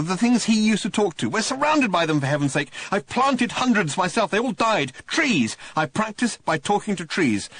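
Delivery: high, overwhelmed Arthur blurting it out; voiced Arthur, emotional. Lonely Arthur greeting Ford, emotional and high. Arthur explains he talks to trees.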